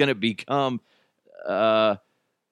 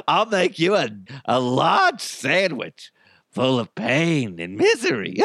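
evil clown. Acted. Robot promises its owner a horrible sandwich, evil clown voice. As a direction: relish every word like a demented clown. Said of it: "It's an evil clown".

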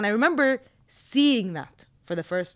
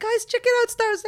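high-pitched excited plea; eager kid; 13 semitones higher than usual. Retold or imitated. Showing cousins a favourite film, imitated high eager kid. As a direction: pitch it way up, like a keen child. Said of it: "I wanna show it to my cousins".

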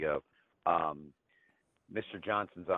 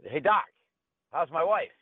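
higher, louder cheery caller; chipper husband; 10 semitones higher, 7 dB louder than usual. Acted. Husband calling his wife's doctor, cheery and higher. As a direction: greet the doctor brightly, like nothing happened. Husband calls third day.

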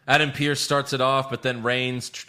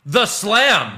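loud, high hammed-up announcer call; Michael Cole call; 10 semitones higher, 9 dB louder than usual. Mocking the commentator's call to his co-host, hammed-up announcer yell. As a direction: belt it out like a TV commentator naming the move. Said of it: "as Michael Cole called them".

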